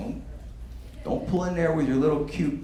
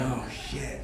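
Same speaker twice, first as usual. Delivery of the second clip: much softer, deflated oh shit; hushed dismay; 11 dB quieter than usual. Retold or imitated. Small guy next to a big man, a hushed scared curse. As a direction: mutter it under your breath, cowed.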